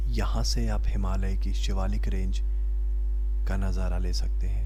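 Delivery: measured documentary narration over music; travel voiceover. Acted. Vlogger introducing the village to viewers, documentary narration. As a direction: read it like a calm travel film intro.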